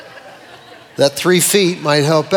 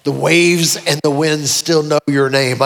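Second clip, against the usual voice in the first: a soft, lower, intimate prayer voice; mild quiet prayer; 6 semitones lower than usual. Acted. Believer praying to the Lord in trouble, lightly hushed prayer. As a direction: pray it under your breath, trusting. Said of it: "You think".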